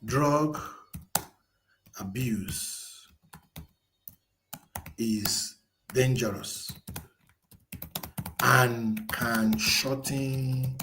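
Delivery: slow, deliberate dictating voice; slow dictation. Acted. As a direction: spell the prompt out word by word. Dictating a prompt to the AI.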